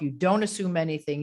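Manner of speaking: ordinary talk, nothing marked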